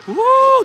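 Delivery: high, loud cheer; excited woo. Real, not acted. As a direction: whoop with delight. Cheer after a save.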